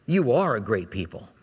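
gentle fatherly encouragement; compassionate. Retold or imitated. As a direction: tell them kindly that you believe it. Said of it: "Now he says to them".

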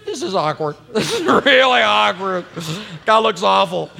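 half-laughing mock onlooker, a hint of embarrassment; laughing aside. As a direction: chuckle through an awkward onlooker's comment. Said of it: "people are like".

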